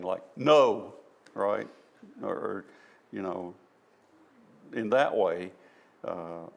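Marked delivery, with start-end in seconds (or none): 0.4-0.9 "no" said loud and emphatic. Shown as a demonstration. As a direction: hit "no" hard, as a firm refusal. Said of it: "we use tone to emphasize something".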